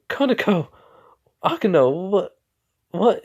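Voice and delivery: high, startled protest at her friends; teased girl's high flustered cry; flustered outcry. Retold or imitated. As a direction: squeal their names in embarrassed shock. Rias flustered at friends' teasing.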